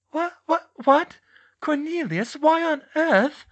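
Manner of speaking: high, loud, sputtering disbelief; aghast, very high-pitched protest; shocked Dolores. A narrator's reading. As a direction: stammer in shock, then demand a reason. Dolores shocked at being overruled.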